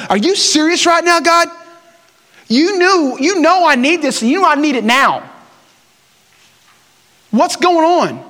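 venting at God in the truck, voice jumping up; exasperated complaint to God, high and insistent; mad at God. Retold or imitated. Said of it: "I've even been mad at him".

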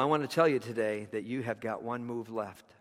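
ordinary talk, nothing marked

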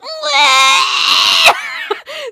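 high shrieking reindeer wail; robot reindeer cry. Retold or imitated. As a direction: wail like a broken animatronic deer. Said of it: "was Comet being like".

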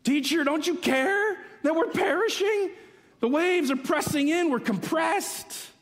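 high-pitched panicked cry, acted out; screaming disciples. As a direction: cry out to the teacher over a storm. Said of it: "the disciples are screaming".

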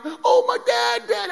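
put-on high, loud, drawn-out child's wail; mock crying kids. Kids running out to their fallen dad, mock crying. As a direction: wail like upset children, hammed up. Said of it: "all my kids came out crying".

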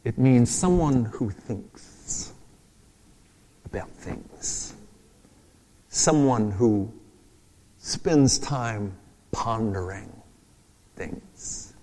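slow musing voice, fading at the end; slow pondering. Shown as a demonstration. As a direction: say it slowly as if thinking it over. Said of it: "where we get our word frown".

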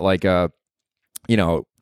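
normal talking voice

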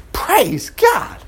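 a sudden high burst of praise; exclaiming praise. Real, not acted. Teacher to class, bursting out in sudden high praise. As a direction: Praise God, jumping up high with joy.

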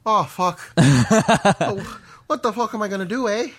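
loud, high, hammed-up Irish-Canadian accent; Irish-Fargo accent. Auditioning as an Irishman in Fargo, hammed-up mixed accent. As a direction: play a flustered Irishman turned Fargo local. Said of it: "you're an Irishman who's recently moved to Fargo".

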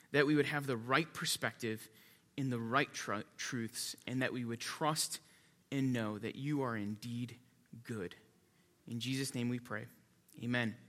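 soft, low-pitched prayer; hushed, reverent praying with pauses. Real, not acted. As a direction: lead the congregation in quiet prayer. Leads the congregation in prayer.

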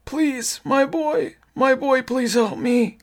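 very high, slow raspy plea; raspy crying voice. A narrator's reading. Raspy thing begs its boy for help, crying. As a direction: beg in a high, strained old rasp. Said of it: "the raspy voice cried".

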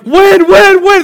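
high, loud squeal of alarm; panicked yelp. Retold or imitated. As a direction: yelp as the current bites. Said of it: "you're like".

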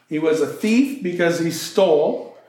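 ordinary talk, nothing marked

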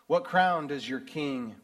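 usual speaking voice

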